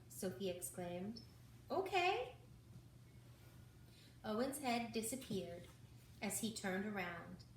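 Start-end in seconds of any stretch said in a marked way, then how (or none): 1.7-2.4 excited okay. A narrator's reading. Sophie agreeing, relieved.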